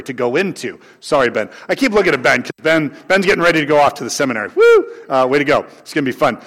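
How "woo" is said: a sudden high, loud whoop; excited cheer. Real, not acted. Pastor cheering Ben off to seminary, excited whoop. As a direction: cheer Ben on with a burst of joy.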